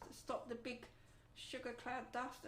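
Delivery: ordinary talk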